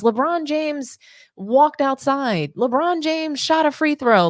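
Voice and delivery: mock news report. Parodying breathless media coverage.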